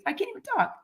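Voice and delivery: barely getting the words out through tears; choked up. Real, not acted. Vlogger overcome talking about her goal, choked up. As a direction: voice catching, high and strained with real emotion. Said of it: "now I get emotional about it".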